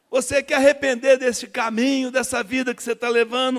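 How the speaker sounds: high, loud pulpit question; preacher's altar call. Retold or imitated. Preacher urging the man to repent, loud high call. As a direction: press him to repent. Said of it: "falação dele".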